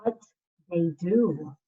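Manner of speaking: gentle, slow storybook reading; reading to children. Acted. Second grade teacher reading a picture book to her class, soothing.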